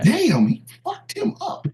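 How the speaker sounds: high, slow stunned ringside call; commentator voice. Retold or imitated. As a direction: react to the beating like a commentator. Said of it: "announcers to go".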